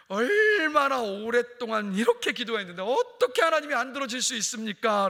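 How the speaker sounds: loud, high-pitched acted lament; hammed-up reproach to a pastor; resentful complaint. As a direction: wail the grievance as the aggrieved parent. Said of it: "원망하면서 목사님께 말하자".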